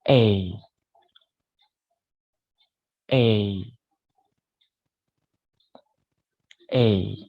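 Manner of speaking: a clear, isolated "A" for students to repeat; teacher modelling "A" for repetition; model the A sound. Shown as a demonstration. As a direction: say "A" as a clean e-to-i glide. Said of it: "Please repeat after me".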